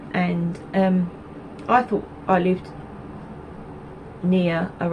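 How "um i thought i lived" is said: ordinary delivery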